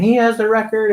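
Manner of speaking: high, excited cheer, retold; imitated cheering. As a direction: mimic a supporter cheering behind you. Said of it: "she's behind me already cheering".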